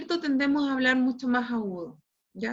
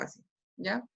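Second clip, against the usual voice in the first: mock hoarse voice; 4 semitones lower, 9 dB quieter than usual. Shown as a demonstration. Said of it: "empiezan a hablar más ronco".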